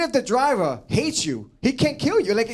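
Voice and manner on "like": usual speaking voice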